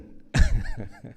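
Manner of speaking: loud chuckle at own joke; amused burst of laughter. Real, not acted. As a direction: laugh out loud after the quip.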